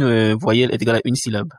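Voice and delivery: usual speaking voice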